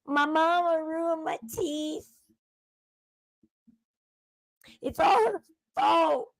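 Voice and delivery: hammed-up imitation of a crying complaint; mock crying.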